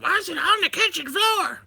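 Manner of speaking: high mock nagging wife voice; hammed-up wife asking about the kitchen floor; mimicking wife. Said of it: "That's exactly how she sounds".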